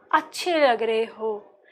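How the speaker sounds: slow, soft, shy compliment to a crush; soft flirty compliment.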